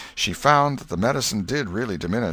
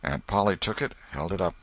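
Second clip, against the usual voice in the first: plain reading voice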